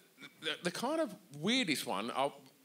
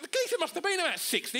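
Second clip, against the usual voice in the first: usual speaking voice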